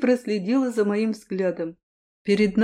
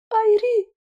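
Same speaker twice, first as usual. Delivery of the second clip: high, loud cry of a name; princess calling out; 14 semitones higher, 4 dB louder than usual. A narrator's reading. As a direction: call his name in alarm from afar. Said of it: "прорезался голос принцессы".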